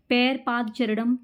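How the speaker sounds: ordinary talk, nothing marked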